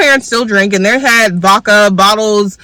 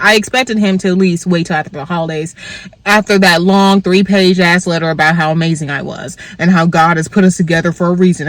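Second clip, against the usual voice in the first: usual speaking voice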